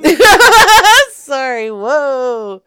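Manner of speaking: laughing apology, high and loud; real outburst of laughter. Real, not acted. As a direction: crack up, then apologise loudly through the laugh. Cracks up after interrupting the recording.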